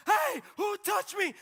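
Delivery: slow, high, loud call; Jesus calling out. Retold or imitated. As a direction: call out across the crowd. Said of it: "And Jesus is like".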